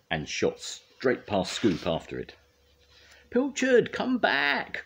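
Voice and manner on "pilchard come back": very high urgent call; crying out. A narrator's reading. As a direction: yell after a runaway cat. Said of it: "cried Scoop".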